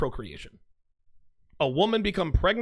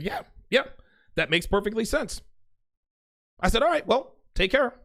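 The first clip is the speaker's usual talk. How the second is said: breezy sarcastic; 6 semitones higher than usual. Retold or imitated. Brushing off the breakup.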